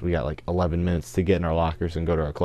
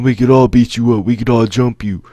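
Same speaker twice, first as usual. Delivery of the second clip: louder, higher imitation of threatening friends; retold threats; 5 semitones higher, 7 dB louder than usual. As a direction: act out the gang's big talk. Friends threaten him in retold quarrel.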